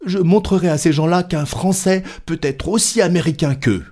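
high-pitched, stung determination; proud little speech, pitched well up; defiant Passepartout. Acted. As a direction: rise to the challenge in a high voice. Voices Passepartout rising to challenge.

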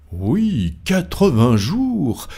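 high, drawn-out cry on the number; exclaiming. A narrator's reading. Stuart breaks into the card game, exclaiming. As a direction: cry it out with swooping pitch. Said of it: "s'écria Andrew Stuart".

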